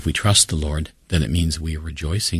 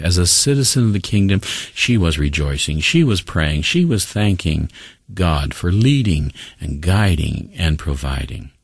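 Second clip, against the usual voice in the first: usual speaking voice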